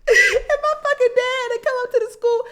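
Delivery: high, loud, laughing-crying words; real laughter cracking the voice; laughing through words. Real, not acted. Laughing while telling.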